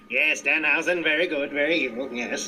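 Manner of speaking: lightly voiced villain, fading low; Danhausen impression. Fan doing Danhausen again, lightly creepy and fading. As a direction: purr it like a proud evil creature. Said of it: "You do that way too well".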